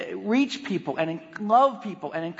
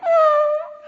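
high, loud "aah" of alarm; sudden high mock scream; mock startled yell; 8 dB louder than usual. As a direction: imitate a panicked driver yelling. Driver's startled reaction, retold.

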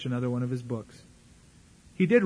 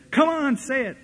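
much higher, urgent exhortation; imitating a hyped preacher; 10 semitones higher, 4 dB louder than usual. Egging the congregation on to chant, much higher and urgent. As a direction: whip the crowd into chanting. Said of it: "he leads them in unison".

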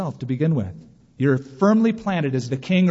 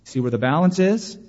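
ordinary talk, nothing marked

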